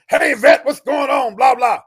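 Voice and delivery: loud, high, overly cheery greeting; bluffing buddy on the phone, all enthusiasm; fake familiarity. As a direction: pretend you remember him, pour on the cheer. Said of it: "like I really remembered him".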